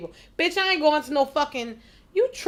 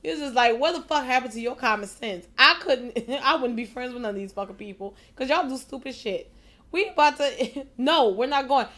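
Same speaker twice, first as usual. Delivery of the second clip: ordinary delivery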